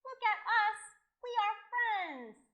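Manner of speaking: high, loud, stretched-out announcement; joyful proclamation. Acted. Boy and dragons celebrating friendship, loud and excited. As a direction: proclaim it proudly, slowing on the last words.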